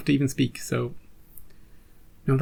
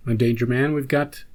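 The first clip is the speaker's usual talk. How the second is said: normal talking voice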